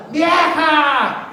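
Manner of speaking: calling out loud and high to the wife; mock yell at wife. Preacher imitating a husband hollering for his wife, loud and high. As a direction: holler for the wife across the house. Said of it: "pero si es la esposa".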